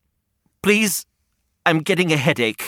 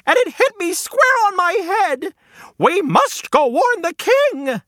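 much higher frantic hen voice, slowing at the end; panicky hen. Acted. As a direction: urge them to warn the king. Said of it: "and yelled".

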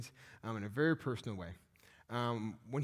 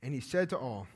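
ordinary talk, nothing marked

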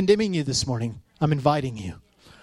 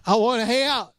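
mock voice of God; 7 semitones higher than usual. God answering in mock dialogue.

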